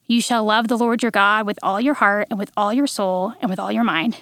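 normal talking voice

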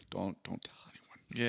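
hushed, slow secret whisper. Retold or imitated. Partygoer warns not to tell anyone, whispering. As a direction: breathe it like a secret nobody should overhear. People now would hide immigrant status.

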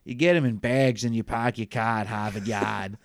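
put-on dropped r Boston accent; park your car at Harvard Yard in Boston accent. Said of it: "mock Boston line, Harvard Yard".

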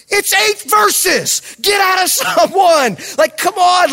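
high-pitched, acted frustration; exasperated outburst. Pastor to a kid stuck on Psalm one, exasperated outburst. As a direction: blurt it out, fed up with the kid. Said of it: "that's the frustration we have as pastors".

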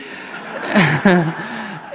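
short chuckle after the punchline; a light amused laugh; laughing at his own story. Real, not acted.

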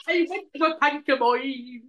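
mock Irish echo of a friend; laughing, put-on Irish twang; mimicked Irish accent. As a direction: imitate a bad Irish accent.

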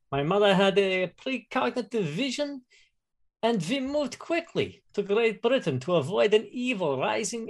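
put-on lightly German-accented psychic noble; proud scion in a mild German accent. Player introduces his German character.

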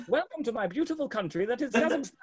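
high, laughing welcome in a put-on accent; the groom welcoming the stranger; mock Kazakh host. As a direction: play the proud host with an accent. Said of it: "walks over to me".

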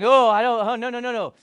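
a sudden loud oh then no no no; flustered refusal. Retold or imitated. Peter backing away from Jesus washing his feet, flustered. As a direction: panic at the honour. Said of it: "And Peter's like".

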